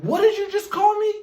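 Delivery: high, loud, stunned mom demanding an answer; a shocked mother rounding on her son; angry mom. Retold or imitated. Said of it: "And she said".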